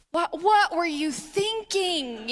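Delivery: slow, stretched-out parental scolding; exasperated parent. Acted. Parent to a kid in trouble, exasperated. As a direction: draw it out like a baffled parent. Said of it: "heard that from your parents".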